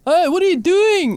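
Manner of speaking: loud, high mocking yobbo call; mock Gold Coast hoon shout; bogan Gold Coast accent. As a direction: bark it like a Goldie bogan. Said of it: "That was not an accent".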